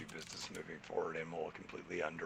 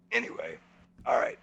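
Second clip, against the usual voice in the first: loud reset; 8 dB louder than usual. Real, not acted. Loud reset to close the show.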